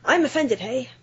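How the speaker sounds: mock offended Northern Irish. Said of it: "they'd be like".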